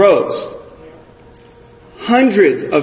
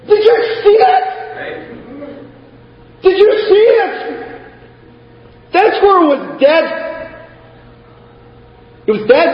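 grab a stranger in shock; incredulous; 10 semitones higher than usual. Retold or imitated. Bystander shouting to others, high incredulous disbelief.

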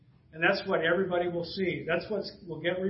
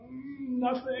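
high, mock-innocent dodge; evasive reply; 7 semitones higher, 4 dB quieter, much slower than usual. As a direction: dodge it like someone caught out. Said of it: "But they kept quiet".

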